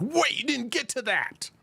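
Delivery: high, soft whiny viewer; mock complaining audience; mock viewer complaint. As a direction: whine like a viewers' chorus. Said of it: "you guys are like".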